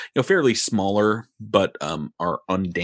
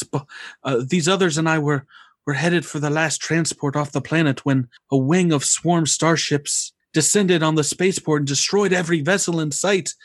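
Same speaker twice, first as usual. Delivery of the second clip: put-on tired, higher older woman voice; weary sorrow; 7 semitones higher than usual. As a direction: sound worn out and grieving, pitched up. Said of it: "kind of greets you in, in a, in a, a weary tone".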